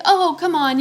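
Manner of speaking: high-pitched mock cajoling; mock coaxing. Neighbors pressing her to stay longer, mock coaxing.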